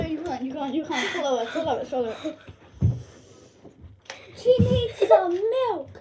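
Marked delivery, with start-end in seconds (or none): 4.4-5.9 mocking. Joking about sourness reaction.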